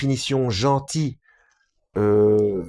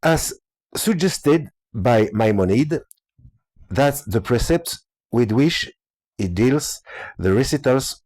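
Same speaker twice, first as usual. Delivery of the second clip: slow, halting English with a French accent; slower than usual. Real, not acted. Reading the translator's English aloud, laboured French accent. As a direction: struggle through English word by word, French-accented. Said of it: "Mon accent anglais, ça va être beau".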